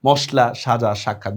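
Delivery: মশলা, সাজা, সাক্ষাৎ each with "sh"; sh sound. Shown as a demonstration. Teacher repeats the right forms, মশলা and the rest with "sh". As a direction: say "moshla, shaja, shakkhat". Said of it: "উচ্চারণ যদি স্বর মতো হয়".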